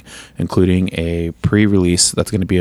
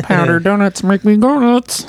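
high slow goofy cartoon voice; silly high jingle voice; 13 semitones higher than usual.

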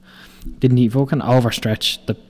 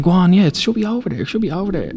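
mock innocent wind-up; 9 semitones higher, faster than usual. Colleague keeps up the prank.

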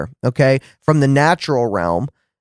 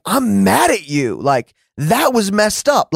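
slow, higher-pitched complaint, not shouted; retold anger; 4 semitones higher, slower than usual. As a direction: air the grievance slowly and emphatically. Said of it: "it was more like".

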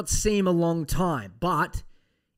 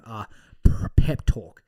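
ordinary talk, nothing marked

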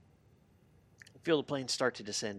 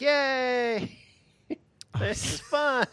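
put-on high, slow delighted baby voice; gleeful baby voice; 11 semitones higher, 3 dB louder, much slower than usual. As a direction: squeal it like a happy baby. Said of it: "she's like".

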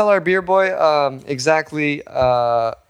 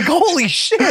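shocked exclamation; 13 semitones higher, slower than usual. Retold or imitated. Shocked uncle still going.

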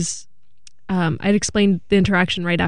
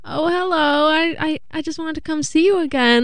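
put-on higher old man voice, pleading; old man pleading with the cashier to go to dinner, pitched up; eager old man; 7 semitones higher than usual. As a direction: mimic the persistent old customer, pitched up. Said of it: "He's like".

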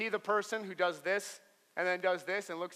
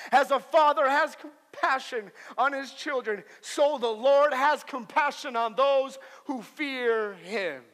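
loud, raised-pitch scripture proclamation; forceful preaching; 6 semitones higher, 9 dB louder than usual. Acted. Pastor reading the Psalm to his congregation, preaching forcefully. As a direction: proclaim the psalm with full conviction.

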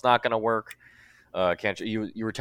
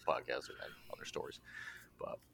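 whispering; 10 semitones lower, 20 dB quieter, faster than usual. Acted. Quiet aside after the show mention.

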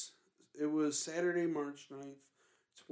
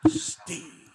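hissed, voiceless impression of a spirit; whispery ghost voice; 14 semitones higher than usual. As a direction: imitate a ghostly recording in a forceful whisper. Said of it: "That's a whispery".

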